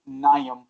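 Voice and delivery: "Naeem" as NIGH-um, stress up front; "Naeem" flattened into NIGH-um; Siri's mispronunciation. Retold or imitated. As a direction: say "Naeem" wrong like Siri, second vowel swallowed. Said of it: "Siri says".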